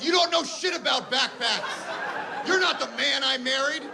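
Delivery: high, slow, laughing mock yell; imagined accusing wife. Stoned husband hears the wife's accusation, high mock wail. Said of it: "All I could hear was".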